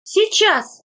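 light, high answering call. A narrator's reading. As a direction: call back as if from behind a hut. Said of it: "крикнула Фаина из-за будки".

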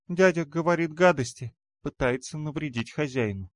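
высокий обиженный голосок малышки; ябедничай тонким детским голоском; детский голос демонёнка. A narrator's reading. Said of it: "произносит мне в куртку демонёнок". (тоненькая детская жалоба)

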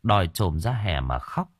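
usual speaking voice

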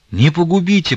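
high, loud pleading moan; a desperate moaned plea for mercy. A narrator's reading. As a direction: beg for mercy at his feet, groaning. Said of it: "простонал Алексей".

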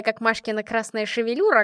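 plain reading voice

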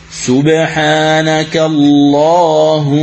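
اللهم slow with the final doubled meem held two beats; slow chant with a two-count ghunna; nasalized meem. Shown as a demonstration. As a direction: hum the meem at the end of اللهم. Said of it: "الميم مشددة".

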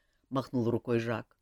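ordinary delivery, nothing marked

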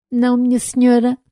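an unsure robot answering politely; timid robot voice. Acted. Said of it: "numa voz baixa e insegura". (voz de robô tímido e inseguro)

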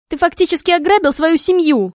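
angry scream, high and loud; furious yell. A narrator's reading. Wife screaming at her husband, furious yell. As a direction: yell it at him in rage. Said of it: "орала она в гневе".

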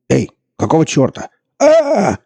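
high, loud cry cut off; shocked yelp into a scream; startled scream. A narrator's reading. Commentator attacked mid-broadcast.

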